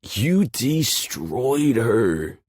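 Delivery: high, loud, slow taunt; jeering. Acted. Novi jeering at Rachel's win. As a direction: rub it in, relishing it. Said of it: "Novi jeers".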